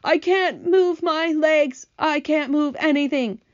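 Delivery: slow, high miserable whine; moaning. A narrator's reading. Centipede stuck in drying paint, moaning. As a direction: drag the words out in a high moan. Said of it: "he moaned".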